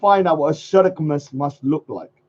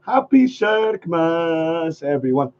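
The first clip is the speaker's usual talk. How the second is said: slow repeated greeting; slower than usual. Shown as a demonstration. Second slow take of the greeting to viewers. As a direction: repeat it slowly. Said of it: "if you say".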